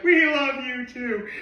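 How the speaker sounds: high sing-out reply, easing off; mock idol gushing. Idols answering adoring fans, high gushing reply. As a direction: return the love in a high voice.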